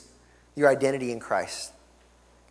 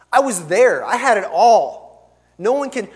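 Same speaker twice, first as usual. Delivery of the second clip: louder, higher mock bragging; acted proud proclamation, raised voice; emphatic boast; 6 semitones higher, 9 dB louder, slower than usual. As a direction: boast it out loudly as Paul, stretching the key words.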